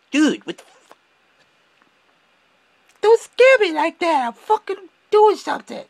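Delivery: high-pitched, loud, startled outrage; startled outburst. Retold or imitated. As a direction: yelp it like you just got jumped from behind. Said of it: "It's like".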